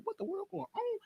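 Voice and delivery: a near-whispered stunned what-is-going-on; quietly baffled aside; hushed disbelief. Retold or imitated. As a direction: drop your voice in disbelief. Stunned reaction to the chaos.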